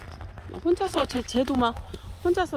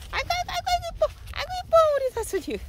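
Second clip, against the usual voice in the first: gushing squeal; faster than usual. Real, not acted. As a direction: squeal with delight at the cat. Gushing over cat. (고양이에게 높고 빠르게 외치는 감탄)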